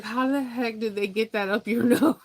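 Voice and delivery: high, incredulous laugh; laughing disbelief. Retold or imitated. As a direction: marvel and laugh through it. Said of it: "you'll be like".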